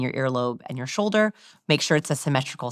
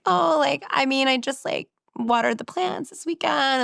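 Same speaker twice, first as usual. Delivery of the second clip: higher, creaky uptalk of a timid speaker; vocal fry quote; 9 semitones higher than usual. Shown as a demonstration. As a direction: imitate a shy person frying her words. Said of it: "they go into a pattern like this".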